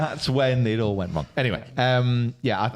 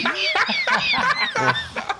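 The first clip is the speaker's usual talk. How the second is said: high laugh; 4 dB louder than usual. Real, not acted. Laughs at British food.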